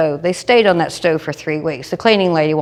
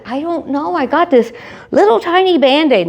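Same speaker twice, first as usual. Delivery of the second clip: high coy; 8 semitones higher than usual. Retold or imitated. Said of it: "I said".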